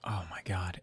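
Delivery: shocked breathless whisper. Real, not acted. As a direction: gasp it out under your breath. Reacting to the body reveal.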